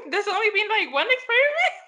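high, fast, laughing; words tumbling out in giggles. Real, not acted. Joking about her thesis while laughing hard.

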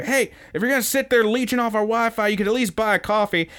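high, fast whiny clerk voice; annoyed clerk. Retold or imitated. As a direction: nag like a fed-up clerk. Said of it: "tells her".

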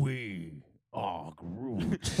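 very low, very slow Groot. Retold or imitated. Friend riffing on Groot in a low slow voice. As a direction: drag each word out deep. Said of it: "Groot bonus track".

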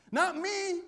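laughing boast. Retold or imitated.

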